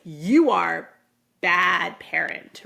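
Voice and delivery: loud, slow mock accusation; mock child's accusation. Angry kid blaming a parent, mock accusation. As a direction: lean hard on each word, lightly acting a cross kid.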